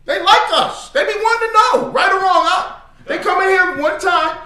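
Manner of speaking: very high, loud exclaiming; worked-up excitement. Retold or imitated. Preacher tells class how outsiders like them, excited. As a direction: push the voice way up, loud and hyped.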